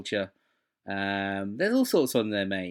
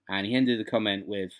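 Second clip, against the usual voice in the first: usual speaking voice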